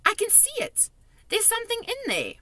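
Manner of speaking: put-on very high girlish exclamation; excited cry. A narrator's reading. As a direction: cry out in discovery. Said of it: "cried out".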